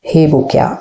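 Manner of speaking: ordinary delivery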